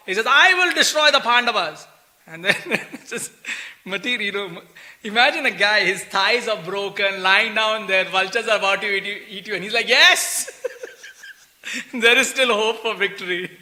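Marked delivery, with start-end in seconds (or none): 0.3-1.9 vow. Retold or imitated. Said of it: "Ashwatthama takes a vow". 9.9-13.5 deluded hope. Retold or imitated. Mocking Duryodhan's deluded hope.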